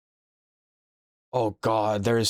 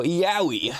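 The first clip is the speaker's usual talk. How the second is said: mock pained yelp; 8 semitones higher than usual. Mock stub-toe cry.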